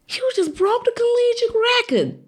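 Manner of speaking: high, wailing tearful cry; imitated bawling. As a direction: repeat it as a high-pitched sobbing wail. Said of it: "he's bawling".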